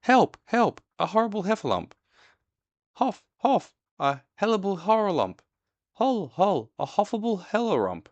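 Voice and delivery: high, loud, garbled frightened shrieks; Piglet crying out in panic. A narrator's reading. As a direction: squeal as you run, words tumbling. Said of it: "still crying out".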